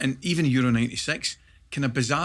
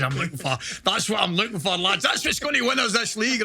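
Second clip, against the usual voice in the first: rising, louder pep-talk cheer; rousing manager; 8 semitones higher, 4 dB louder than usual. Retold or imitated. Manager rallying the squad after the brawl, building excitement. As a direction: build it up like a gaffer firing up the lads. Manager bursts into dressing room.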